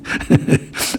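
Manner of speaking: short breathy laugh, real; amused chuckle. Real, not acted. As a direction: chuckle softly under the breath. Amused at people demanding an explanation.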